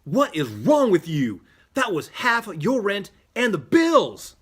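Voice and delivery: loud, high-pitched furious shout; livid yell. Acted. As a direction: yell at a friend in outrage. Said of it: "Adam is livid as he yells".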